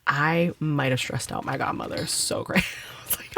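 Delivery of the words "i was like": ordinary talk, nothing marked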